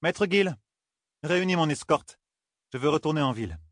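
queen calling out an order, pitched high. A narrator's reading. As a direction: lift the pitch to summon a servant, easing down at the end. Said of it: "Elle éleva un peu la voix".

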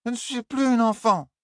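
high, offended protest; indignant drunk Elaine. Acted. Elaine stamping her foot at Tom, high and indignant. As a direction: insist she's no child.

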